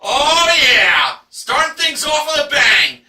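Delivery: loud, high-energy whoop of approval; excited cheer. Real, not acted. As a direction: cheer the big opening prize loudly. Cheering the big prize.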